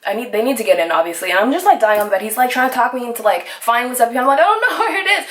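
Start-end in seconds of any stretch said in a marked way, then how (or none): 4.4-5.3 laughing panic. Retold or imitated. Panicked answer to dispatcher.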